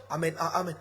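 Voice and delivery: usual speaking voice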